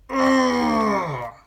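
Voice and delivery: loud, high "ugh" of disgust; disgusted groan. Real, not acted. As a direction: let out a long, pained groan of disgust. Disgusted reaction to the ad.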